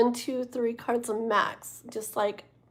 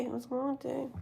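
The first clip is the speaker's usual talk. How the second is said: much softer, muttered echo to herself; quiet repeat; 10 dB quieter than usual. Real, not acted. Streamer echoes to herself how tiring the day was, softly. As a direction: drop the volume and repeat it to yourself.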